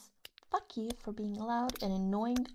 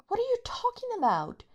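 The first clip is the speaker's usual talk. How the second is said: much higher, louder, fast; incredulous outburst; 13 semitones higher, 7 dB louder, faster than usual. Acted. Scoffing at the partner's offer, high, fast and loud. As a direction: fire back in scornful disbelief.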